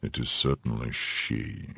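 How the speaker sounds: a soft, slow murmur to himself. Acted. Athos recognizes Milady and murmurs to himself. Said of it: "murmured Athos".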